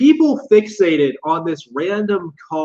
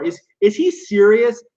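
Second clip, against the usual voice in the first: usual speaking voice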